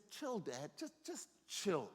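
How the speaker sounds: acted-out easygoing plea to slow down; laid-back son. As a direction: draw out a relaxed "chill" with pauses. Son telling dad to chill.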